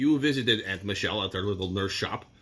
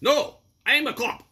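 higher-pitched mock police declaration; defiant cop; 13 semitones higher, 4 dB louder than usual. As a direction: lightly act out a cop standing up to a robber. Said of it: "And you said".

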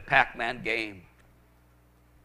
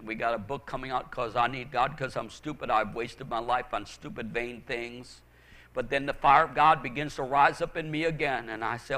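usual speaking voice

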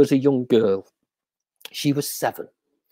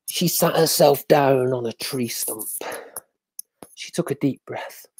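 plain reading voice

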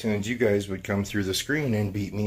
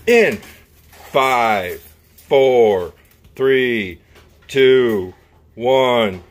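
loud countdown; 3 semitones higher, 10 dB louder, much slower than usual. Real, not acted. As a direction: call it out like a race starter. Countdown starting the egg hunt.

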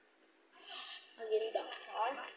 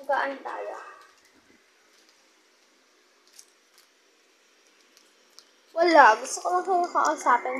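chewing while talking; 10 dB louder than usual. Real, not acted. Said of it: "habang kumakain ako".

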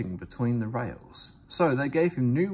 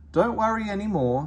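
self-important, pitched up high; 9 semitones higher, 5 dB louder than usual. A narrator's reading. Sir Handel reassuring friends, self-important and pompous. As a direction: puff yourself up grandly. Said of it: "said Sir Handel importantly".

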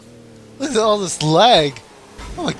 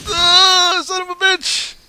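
raging gamer; 12 semitones higher, 5 dB louder, slower than usual. Real, not acted. Killed in game.

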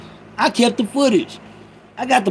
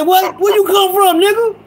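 higher, mock astonished; surprised quote; 9 semitones higher than usual. Retelling seeing his friend beside him, mock surprised. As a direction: act out being caught off guard. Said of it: "I said".

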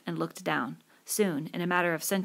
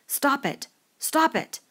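high, loud, urgent shout; shouted order; 9 semitones higher, 5 dB louder, slower than usual. A narrator's reading. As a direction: yell at the others to stop. Ordering boys to stop, breaking silence.

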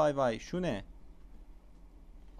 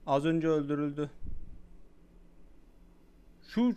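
usual speaking voice

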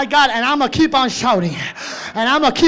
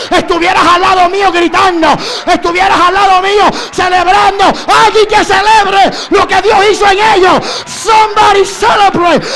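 higher, fervent crying-out sermon; preaching at full force; 5 semitones higher, 4 dB louder than usual. Acted. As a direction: drive the church to cry out. Preacher calling for celebration.

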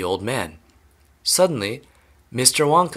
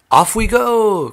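drawn-out, high, loud rallying call; excited cry; 11 semitones higher, 7 dB louder, slower than usual. A narrator's reading. Wonka setting his visitors off, excited cry. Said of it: "cried Mister Wonka".

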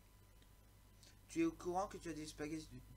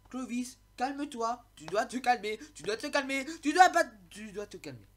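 higher and louder, telling himself to calm down; loud self-scolding; 7 semitones higher, 11 dB louder than usual. Real, not acted. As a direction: bark at yourself to chill. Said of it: "Faut que je me calme". (se crie à lui-même de se calmer)

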